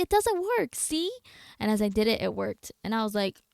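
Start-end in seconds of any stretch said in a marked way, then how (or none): 0.0-1.1 high-pitched mock protest; quoted protest. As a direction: complain in a higher pitch, replaying yourself. Quoting own earlier complaint.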